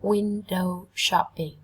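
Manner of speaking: "Window shopping" slow, stress on win-; first syllable stress. Shown as a demonstration. Teacher slowly modelling first-syllable stress. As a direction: stretch it slowly and lean on win-. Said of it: "trọng âm đều dâm tiết đầu tiên".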